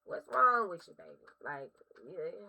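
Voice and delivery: normal talking voice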